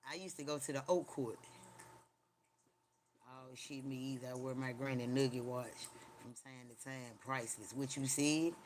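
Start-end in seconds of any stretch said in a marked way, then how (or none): none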